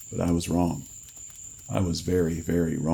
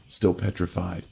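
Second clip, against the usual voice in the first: usual speaking voice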